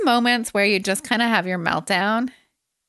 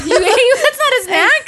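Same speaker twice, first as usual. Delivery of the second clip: much higher, slow, louder, cracking with laughter; real incredulous laughter; laughing disbelief; 13 semitones higher, 6 dB louder, much slower than usual. Real, not acted. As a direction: squeal it out laughing.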